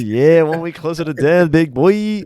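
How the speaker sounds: high, loud goofy voice teasing his friend; mocking imitation. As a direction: ham it up as a goofy bro. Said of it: "It's like".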